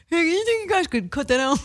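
high, laughing embarrassed plea; mock high voice. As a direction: ask them to cut it with a giggly high voice. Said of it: "then I'm gonna be like".

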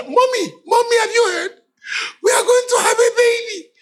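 mock excited husband. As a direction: ham up the thrilled new father. Mocking excited husband calling wife.

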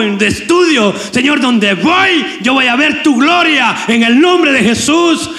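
high, fervent call-and-response confession; congregational declaration. Shown as a demonstration. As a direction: lead them in declaring victory. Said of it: "dígale". (declaración ferviente)

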